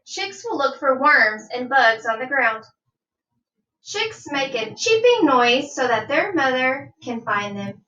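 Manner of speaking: normal talking voice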